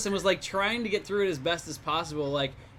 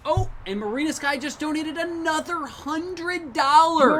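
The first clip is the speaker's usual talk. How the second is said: higher, loud delighted surprise; excited; 10 semitones higher, 7 dB louder, slower than usual. Real, not acted. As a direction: gasp it out in amazement. Excited about a big donation.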